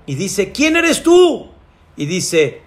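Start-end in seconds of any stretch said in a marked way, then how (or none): 0.5-1.5 high, drawn-out shouted question; calling out. Acted. Climber to a mysterious voice, calling out high. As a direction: call out into the night.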